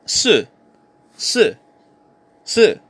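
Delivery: SI short, falling steeply from high; "si" with a quick downward fall; Mandarin pronunciation. Shown as a demonstration. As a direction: drop "si" sharply in fourth tone. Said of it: "Number four".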